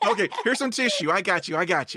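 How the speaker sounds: quick and high through a laugh; laughing, teasing the guest who might cry. Real, not acted. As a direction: laugh while offering the tissue.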